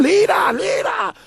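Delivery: very high mock shrieks; imitated screaming. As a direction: squeal the word "Leader" twice, way up high. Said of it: "This one guy starts screaming".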